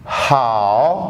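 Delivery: low dipping tone on हाओ; Mandarin third tone. Shown as a demonstration. Teacher demonstrating the Chinese syllable हाओ on its own, low dipping tone. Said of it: "दूसरा लफ्ज़ लूँ अलग करके वो है हाओ".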